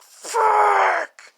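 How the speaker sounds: high, long strained wail; loud drawn-out cry; anguished mock cry. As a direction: wail the swear out in mock despair. Replays the cat's silent curse.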